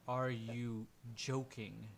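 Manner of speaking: very slow, drawn-out disbelief; incredulous NPC. Acted. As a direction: stretch each word out in disbelief. GM acts NPC's incredulous reply.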